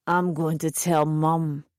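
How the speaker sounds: low, slow slurred threat; slow, low drunk voice; drunken slur. A narrator's reading. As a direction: slur it heavily, plastered. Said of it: "he slurred".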